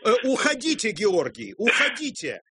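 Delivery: high, hammed-up panic at caller; mock alarm. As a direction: shoo him off in fake alarm. Host feigns alarm at caller.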